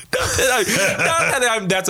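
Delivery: words through an embarrassed laugh. Real, not acted. Laughing while retracting.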